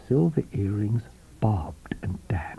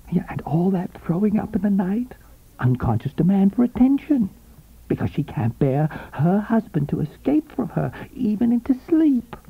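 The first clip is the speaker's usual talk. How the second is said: higher, eager, sympathetic woman's voice; eager knowing woman; 8 semitones higher than usual. A narrator's reading. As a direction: agree with relish. Coral's voice in the story.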